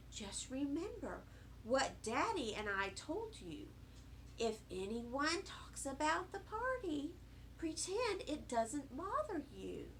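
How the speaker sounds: soft, gentle motherly advice; reassuring mother. A narrator's reading. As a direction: counsel the child quietly. Mother gently advises child.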